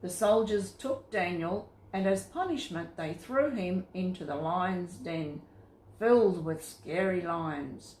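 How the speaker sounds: usual speaking voice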